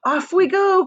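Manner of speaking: high, loud call to set off; excited cry. A narrator's reading. Wonka calls his guests to the boat, loud and high. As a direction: cry out with glee. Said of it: "cried Mister Wonka".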